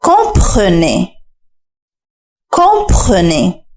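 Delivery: "Comprenez" spoken very slowly, syllables apart; slow drill. Shown as a demonstration. Teacher builds the word for learners to repeat, slowly. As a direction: drag out "Comprenez" for repetition. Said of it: "ردد ورائي بصوت مرتفع".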